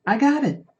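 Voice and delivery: high, half-joking Australian accent. Shown as a demonstration. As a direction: toss off a quick mock Australian accent. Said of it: "I shouldn't do an Australian accent anymore".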